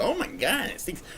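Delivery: a jump up high on oh my God, then dropping away; mock disgust. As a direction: play the disgusted bystander. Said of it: "somebody else's face and go".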